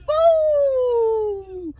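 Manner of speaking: very high, loud drawn-out woo; wild whoop. Real, not acted. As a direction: howl it like you're losing it. Recalling losing it.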